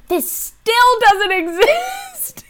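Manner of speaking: very high, slow, loud wail; high dramatic wail. Retold or imitated. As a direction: wail it out in disbelief. Dramatic quoted reaction.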